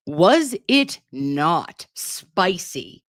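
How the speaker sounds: "Was it not spicy?" stretched very slowly, word by word; drawn-out emphasis. Real, not acted. Streamer hyping the hearing to chat, "Was it not spicy?" slow and emphatic.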